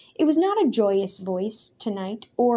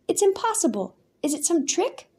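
much higher, startled protest; disbelief; 9 semitones higher than usual. A narrator's reading. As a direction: refuse to believe it. Daniel in disbelief.

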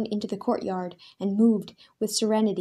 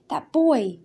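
stammering; 6 semitones higher, 5 dB louder than usual. A narrator's reading. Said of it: "he stammered".

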